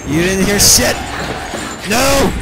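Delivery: frantic high shouts while running; panicked yelling. Real, not acted. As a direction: yell in real panic. Chased again, panicked.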